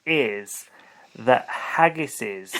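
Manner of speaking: very slow, drawn-out reveal; slow build-up. Real, not acted. Cohost teasing a silly theory, slow suspenseful build-up. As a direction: stretch each word for suspense. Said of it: "is that the plural of haggis?".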